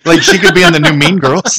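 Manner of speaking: chuckled add-on to a joke; laughing. Real, not acted. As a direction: keep the joke going while laughing. Joking amid shared laughter.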